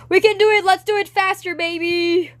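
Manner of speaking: loud, high hype-up yell; high-pitched, full-voiced pep shout; excited shouting. Real, not acted. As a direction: shout it like a rallying cheer. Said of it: "I'm literally shouting on my stream".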